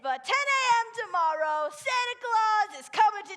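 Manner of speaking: loud public address; store announcement. Acted. Manager announces over store.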